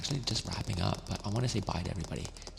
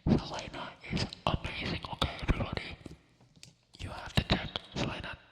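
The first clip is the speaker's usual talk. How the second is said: ASMR whisper; 3 dB quieter than usual. Real, not acted. Streamer urging viewers to check out a friend, whispered. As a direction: whisper the praise deliberately.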